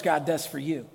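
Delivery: ordinary delivery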